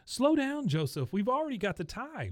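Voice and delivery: very high fussy coach voice. Retold or imitated. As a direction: hold the kid back in a high anxious call. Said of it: "the coach is".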